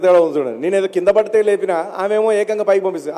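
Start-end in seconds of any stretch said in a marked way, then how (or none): none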